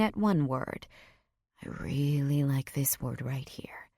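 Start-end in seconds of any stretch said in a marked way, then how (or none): 1.6-3.9 husky whisper. A narrator's reading. Edgar's teasing lines.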